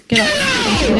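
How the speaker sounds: normal talking voice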